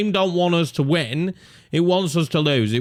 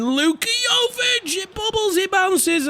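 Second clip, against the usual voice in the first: excited commentary; slower than usual. Real, not acted. Live chance, calling play.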